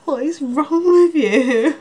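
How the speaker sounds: high, loud, drawn-out exasperated demand. Real, not acted. Vlogger confronting someone nearby, exasperated. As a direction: demand it slowly and loudly, at your wit's end.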